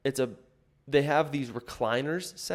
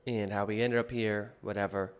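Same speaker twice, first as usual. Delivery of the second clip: ordinary talk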